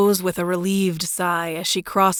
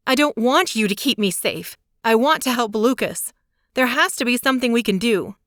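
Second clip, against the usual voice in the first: higher voice, rushing at the end; pleading and pushing back; Claire's voice, desperate; 5 semitones higher than usual. A narrator's reading.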